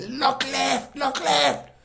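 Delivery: high, loud shouted warning; urgent yell. Retold or imitated. Shouting a warning to the boat's helmsman, urgent yell. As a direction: call out to warn the helmsman.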